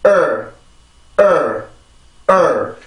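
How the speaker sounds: 을 as a stressed English "er", not a Korean L; tense the throat, then release 을 as "er"; isolated "er" with a firm onset; stressed r-colored schwa. Shown as a demonstration. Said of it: "이렇게 강세를 만들어줍니다".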